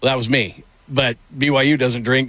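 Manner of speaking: usual speaking voice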